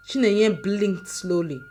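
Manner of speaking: plain reading voice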